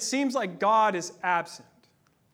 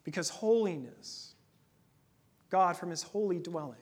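usual speaking voice

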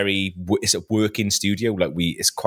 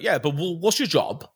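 higher-pitched West Wales cameraman; West Wales accent; 7 semitones higher than usual. Retold or imitated. Cameraman asking the host their job, West Wales accent. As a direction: imitate him in West Wales tones. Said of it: "very West Wales accent".

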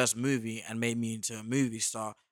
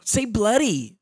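imitating American girls; 10 semitones higher, 7 dB louder, slower than usual. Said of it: "these girls always say".

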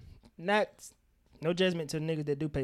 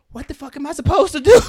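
much higher and much louder; throwing hands up in disbelief; exasperated shout; 11 semitones higher, 15 dB louder than usual. Real, not acted. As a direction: shout the helpless question. Exasperated outburst.

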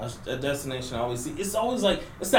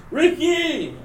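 calling out; 13 semitones higher, 10 dB louder than usual. Retold or imitated. Calling out movie character name.

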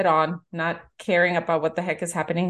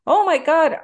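higher, louder "oh my God"; excited yell; 9 semitones higher, 5 dB louder than usual. Retold or imitated. Family recognizing the dad from Stranger Things, yelling. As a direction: shout in recognition. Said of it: "Everybody yells".

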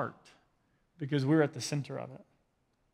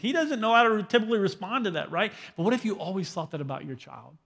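normal talking voice

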